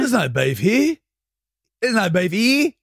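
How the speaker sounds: high-pitched, with "here" stretched into a rising HEEE; teasing vowel demo. Shown as a demonstration. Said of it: "No, try ear".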